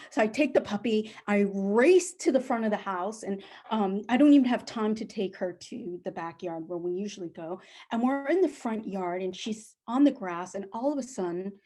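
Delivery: ordinary delivery, nothing marked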